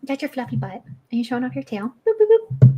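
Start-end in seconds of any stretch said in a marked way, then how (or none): 2.1-2.7 much higher little sound-effect boops; playful boop. Real, not acted. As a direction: tap the cat's nose with each boop. Booping the cat.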